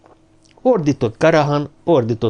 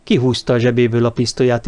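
ordinary delivery

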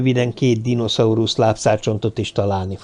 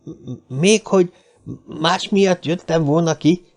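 higher-pitched flustered protest after a stumble; scared stammer; 6 semitones higher than usual. A narrator's reading. Little man accused of lying, stammering scared denial. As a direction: stumble on the first sound, then protest in alarm. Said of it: "A kisember halálra vált arccal".

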